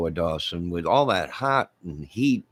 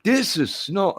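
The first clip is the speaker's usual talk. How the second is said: slow, higher, hammed-up Irish accent; Irish accent, Crocodile Dundee line; bad Irish take on Australian; mock Irish accent; 7 semitones higher, slower than usual. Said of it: "I'm still talking like an Irishman".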